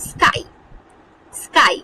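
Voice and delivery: স্কাই with the final Y sounding as আই; sky ending in I. Shown as a demonstration. Teacher models স্কাই, the Y sounding like আই. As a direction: say স্কাই clearly, ending on a full আই. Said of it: "এখানে আই".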